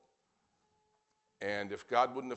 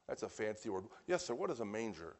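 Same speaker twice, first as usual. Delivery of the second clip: normal talking voice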